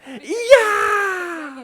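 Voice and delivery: long, high celebratory yell; mock triumphant shriek; imitating pumped celebration. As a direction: scream a drawn-out victory yell. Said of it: "She is so pumped".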